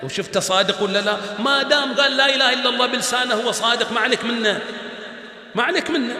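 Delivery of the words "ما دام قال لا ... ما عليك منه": fiery preaching. Real, not acted. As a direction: preach it at full force to the hall. Preacher drives home the point.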